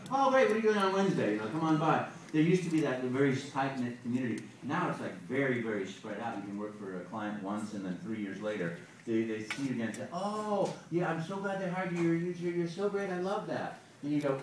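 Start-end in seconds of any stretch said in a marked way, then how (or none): 0.1-2.1 high, loud, quick enthusiasm; bright-eyed, rapid-fire client invitation; eager client booking. Acted. Acted client booking the actor. 10.1-13.8 high-pitched mock gushing; acted, effusive delight; gushing client. Acted client greeting a returning actor.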